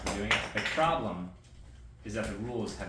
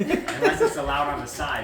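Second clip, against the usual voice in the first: laughing; 9 dB louder, slower than usual. Real, not acted. Joking about Afrin in the ring.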